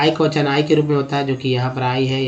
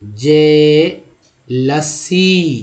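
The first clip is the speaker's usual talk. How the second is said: slow syllable demo; much slower than usual. Shown as a demonstration. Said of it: "next है".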